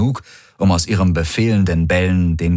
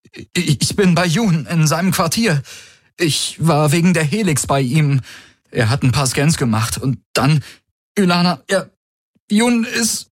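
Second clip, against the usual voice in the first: higher, halting fake panic; feigned breathlessness; 9 semitones higher than usual. Valur on the comm to Ylana, faking breathless shock. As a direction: act out of breath. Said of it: "er gab sich atemlos".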